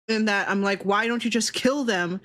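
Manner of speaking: normal talking voice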